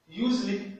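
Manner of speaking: Usually dit plus haut; Usually en modèle, voix haute; English model word. Shown as a demonstration. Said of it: "là, c'est the". (Usually à l'anglaise, plus haut)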